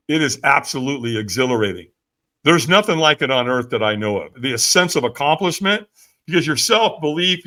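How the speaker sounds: usual speaking voice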